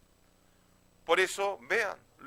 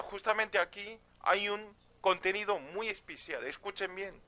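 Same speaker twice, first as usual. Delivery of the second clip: ordinary talk, nothing marked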